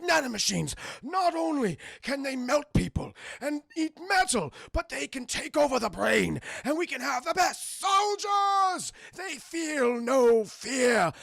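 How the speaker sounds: high villain rant with stretched-out endings. Retold or imitated. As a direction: build into grand, loud mad-scientist boasting. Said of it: "he talks like this throughout the entire movie".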